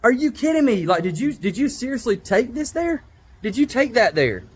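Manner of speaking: high, incredulous "What?" then stunned questions; retold disbelief. Reacting to a fan's long church-themed comment, incredulous. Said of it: "and I'm just like".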